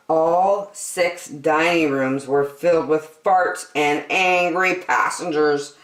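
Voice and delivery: plain reading voice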